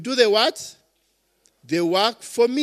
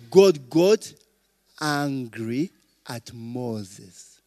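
slow and grave; 8 semitones lower, much slower than usual. Acted. Preacher stressing God's anger.

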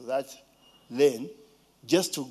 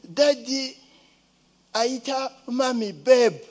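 put-on child's voice; 6 semitones higher, 4 dB louder than usual. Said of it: "there was a time when she said".

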